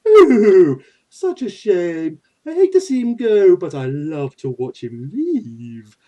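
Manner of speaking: slow, lingering villainous sigh; Pegasus Maximilian. Acted. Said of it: "Pegasus Maximilian".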